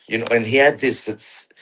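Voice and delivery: ordinary talk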